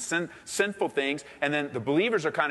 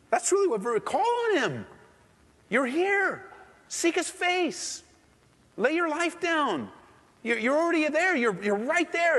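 high, impassioned pleading call; preacher urging the congregation, pitched high and fervent; fervent preaching; 13 semitones higher than usual. Real, not acted. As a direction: lift them up to seek God's face.

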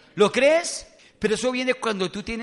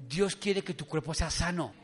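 normal talking voice

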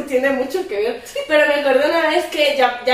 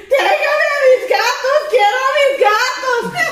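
higher, slow, laughing fake sob; mock crying; 10 semitones higher, slower than usual. She begs her mom on the phone for her cats, mock sobbing. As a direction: whine like a kid begging for your cats. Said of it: "llamaba a mi mamá llorando".

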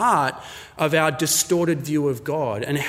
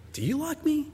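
soft, very high-pitched disbelief; incredulous question; 12 semitones higher, 7 dB quieter than usual. Acted. Rejected suitor questioning his girlfriend, incredulous. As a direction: ask it high and soft, stressing YOU. Suitor doubting her love, second take.

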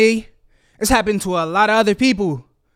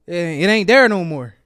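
ordinary delivery

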